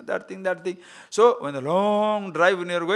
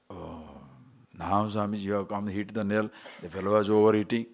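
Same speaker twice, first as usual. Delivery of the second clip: low, soft voice of a listener giving in; lightly acted, hushed admission; conceding listener; 9 semitones lower, 5 dB quieter than usual. Voicing a listener conceding the point.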